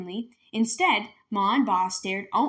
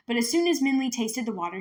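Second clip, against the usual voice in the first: plain reading voice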